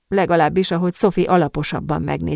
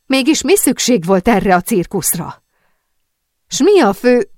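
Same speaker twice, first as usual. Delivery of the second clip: loud, higher-pitched angry demand; shouting; 4 semitones higher, 6 dB louder than usual. A narrator's reading. Sophie demands that Zambelli explain the circus, shouting. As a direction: press for an answer in a raised, exasperated voice. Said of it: "kiabált Sophie".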